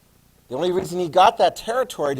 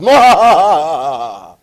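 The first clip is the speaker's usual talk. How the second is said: mock hearty laugh; 12 dB louder than usual. Tsar laughing at drenched guests, loud hammed-up laugh. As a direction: laugh your head off at the soaked guests. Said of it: "laughing his head off".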